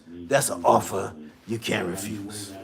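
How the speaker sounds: low, quiet mob boss voice; low Godfather menace; Godfather impression. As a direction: say "offer you can't refuse" like a Mafia don. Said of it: "ever watched The Godfather".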